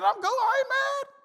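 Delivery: very high, laughing squeak of denial; laughing mock protest. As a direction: insist you're calm while cracking up. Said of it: "I said".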